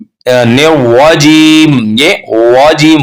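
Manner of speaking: slow वाजीम with rounded lips; wrong rounded waw. Shown as a demonstration. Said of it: "वाजीम नहीं पढ़ेंगे". (गलत वाजीम, धीमा)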